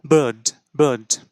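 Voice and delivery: বার্ড in a British accent, r dropped, falling; British accent বার্ড with no rolled r; teacher showing the British accent. Shown as a demonstration. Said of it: "British অ্যাকসেন্টে খুব একটা prominent না".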